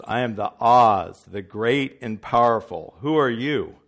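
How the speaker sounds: loud, booming wizard. Retold or imitated. Preacher voicing the wizard to Dorothy, loud and booming. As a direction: boom it out like a grand wizard. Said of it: "The wizard says".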